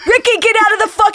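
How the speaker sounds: quick, high, loud mock holler; Boston mom yelling. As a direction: imitate an irate Boston mother, fast. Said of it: "I saw a woman yell at her toddler in Boston".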